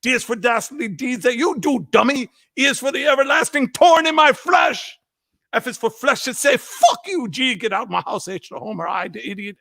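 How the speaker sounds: high mock Jamaican tirade; imitated Jamaican dad. Drunk Jamaican dad cursing his son, imitated tirade. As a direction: rant through the alphabet in a put-on Jamaican accent. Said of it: "If I try to do Jamaican".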